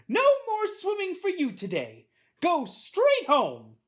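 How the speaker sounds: high, loud, slow scolding; screaming teacher. A narrator's reading. Teacher screaming at boy in the pool, angry and loud. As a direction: order a child out with outraged force. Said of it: "screams at him".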